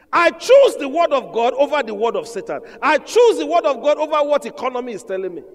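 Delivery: loud, high, forceful preaching; emphatic declaration. Real, not acted. Preacher declares choice of God's word over economy, emphatic declaration.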